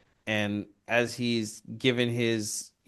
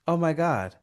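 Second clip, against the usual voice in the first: higher-pitched retold epiphany; mock sudden realization; 6 semitones higher than usual. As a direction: imitate someone struck by a revelation. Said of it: "all of a sudden he realizes".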